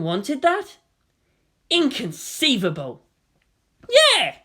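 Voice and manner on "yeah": sudden high shout from the crowd; high crowd shout of agreement. A narrator's reading. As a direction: yell it out in agreement. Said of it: "shouted someone from the protesting group".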